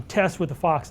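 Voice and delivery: ordinary talk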